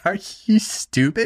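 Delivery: incredulous retort. Retold or imitated. Retelling reaction to honking driver.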